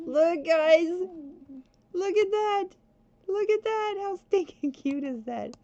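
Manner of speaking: high, loud, thrilled squealing; excited. Real, not acted. Gamer showing viewers the Baby Groot costume, excitedly squealing. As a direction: show it off, bursting with excitement. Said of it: "I just got so excited".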